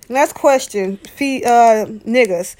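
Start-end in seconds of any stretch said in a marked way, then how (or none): none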